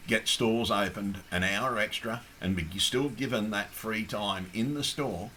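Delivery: ordinary talk, nothing marked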